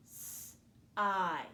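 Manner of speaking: "S-I" as a held sss, a gap, then long eye; sounded out. Shown as a demonstration. Teacher has pupils listen for vowel, "S-I" sound by sound. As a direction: stretch and separate the two sounds of "S-I". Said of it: "listen for that vowel sound".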